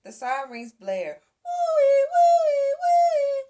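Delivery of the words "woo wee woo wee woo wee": very high, loud wailing siren woo-wee; siren imitation. A narrator's reading. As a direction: wail it like a fire siren. Siren sound in story.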